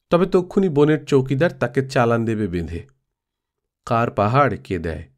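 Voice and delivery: ordinary delivery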